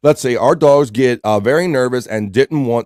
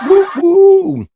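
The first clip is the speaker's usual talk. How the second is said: high hooting train whistle with a laugh; train whistle woo; 7 dB louder than usual. Pretend. Host mimicking a train whistle for chat, playful hoot.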